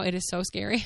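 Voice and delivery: ordinary delivery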